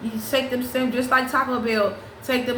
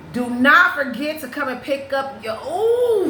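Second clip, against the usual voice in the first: higher, emphatic mock outburst breaking into a high ooh; feeling it hard; 4 semitones higher, 3 dB louder, slower than usual. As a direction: act out being too overcome to finish. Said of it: "she feeling that shit so hard".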